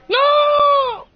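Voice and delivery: high, drawn-out cry of no; dismayed cry. Real, not acted. Gamer reacting to the game, high dismayed cry.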